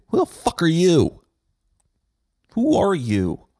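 high, loud indignant yell; angry outburst. Acted. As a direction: shout it at whoever's bossing you. Snapping at people bossing him.

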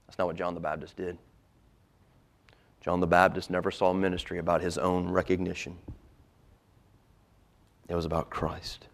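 ordinary delivery

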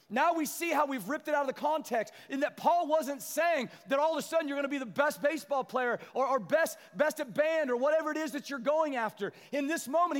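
high, loud preaching; full-force preaching. Acted. Pastor corrects the verse for the church, loud and high. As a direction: press the point hard.

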